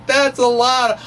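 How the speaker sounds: slow and high with a laugh. Real, not acted. Lecturer marvels at the count to listeners, laughing. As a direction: laugh at how enormous it is. Amused at huge number.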